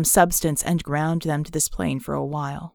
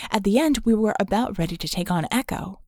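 ordinary delivery

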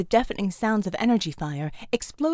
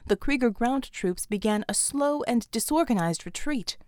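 plain reading voice

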